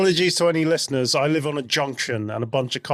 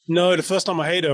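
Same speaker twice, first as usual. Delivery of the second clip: ordinary talk, nothing marked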